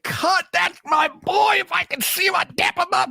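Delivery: high, shouted hype for a favorite; excited hype. Retold or imitated. Fan shouting praise for the clone Cut, high and excited. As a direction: cheer him on like a proud buddy.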